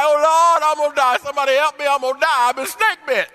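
high, loud whiny panic; complaining Paul. Retold or imitated. Paul bitten by a snake, whining high and loud to the Lord. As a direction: wail it like a man sure he's dying. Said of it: "He started complaining".

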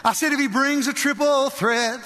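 high, chanted rhyme with fervor; rhythmic pulpit declaration; defiant preaching. Retold or imitated. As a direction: declare it boldly at the devil.

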